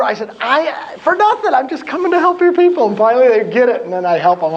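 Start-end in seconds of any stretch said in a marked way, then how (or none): 0.4-2.9 high, earnest insistence; insistent. Retold or imitated. To the group, raised earnest insistence. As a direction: press the point that it's free.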